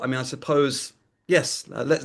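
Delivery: usual speaking voice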